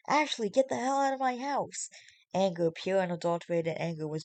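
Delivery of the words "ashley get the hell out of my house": higher angry shout; angry yell. Acted. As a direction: yell her out of the house. Marshall yelling at Ashley.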